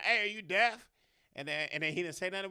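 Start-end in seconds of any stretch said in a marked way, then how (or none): 0.0-0.9 loud, high mocking call; taunting yell. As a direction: shout it up the hill to taunt him. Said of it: "we was like".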